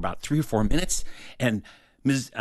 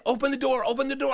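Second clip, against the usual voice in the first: high, frantic repeated order; acted child's panic, pitched way up; urgent demand; 14 semitones higher, faster than usual. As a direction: press them urgently in a high voice. Acting out urgent order at the door.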